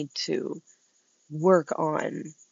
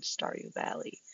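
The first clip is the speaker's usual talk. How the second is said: whispered; 13 dB quieter than usual. Retold or imitated. Punchline answer, unvoiced.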